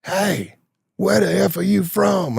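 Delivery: high, slow mock yell across the bar. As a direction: call out slow and high like a rough biker. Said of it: "He yells at me".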